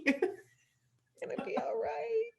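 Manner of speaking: teary retelling of the teacher's reassurance; teacher soothing through tears. Retold or imitated. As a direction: comfort her while your voice cracks. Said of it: "she was like".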